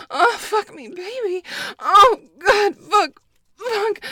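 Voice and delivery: high, loud climactic cries; loud high-pitched cries; loud climax moans. Acted. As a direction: let it break out at the peak. Climax moaning.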